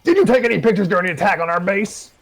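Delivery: high, loud demand; loud, high accusing question; sergeant grilling. A narrator's reading. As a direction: interrogate the journalist hard. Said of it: "Sergeant Rook turned".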